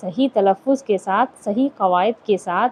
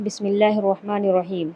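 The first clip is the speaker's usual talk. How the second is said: recitation; 3 dB quieter than usual. Acted. Quran recitation opening a lesson.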